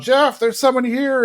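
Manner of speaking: high-pitched call, no real volume; retold yell. Kid calls the napping manager, mock yell. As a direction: imitate a kid calling out to the boss. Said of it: "kid yells out".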